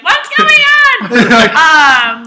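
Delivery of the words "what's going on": high shrill neighbor voice; nosy neighbor. Pretend. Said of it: "some neighbor who's like".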